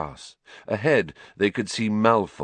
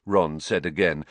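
plain reading voice

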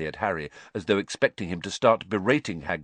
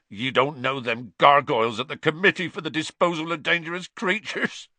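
choked; 8 semitones higher, 4 dB louder than usual. Acted. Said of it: "choked Hagrid".

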